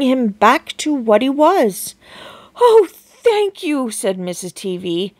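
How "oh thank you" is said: grateful. A narrator's reading. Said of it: "relieved mother".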